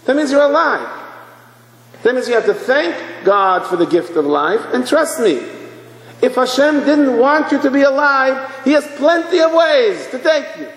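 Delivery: high-pitched, fervent sermon delivery; urgent exhortation from the pulpit; impassioned preaching. Real, not acted. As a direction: drive the message home in a ringing high voice. Preaching climax.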